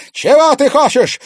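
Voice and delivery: high, loud stern demand; menacing shout. Acted. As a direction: demand it threateningly. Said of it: "грозно крикнула жрица".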